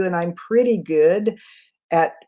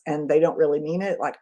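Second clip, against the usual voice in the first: normal talking voice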